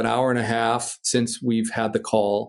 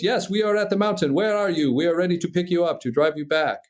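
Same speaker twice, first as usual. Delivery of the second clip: put-on higher French-accented English, easing down; imitated French accent; 5 semitones higher than usual. Instructor on radio offering a pickup, imitated French accent. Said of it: "And he goes".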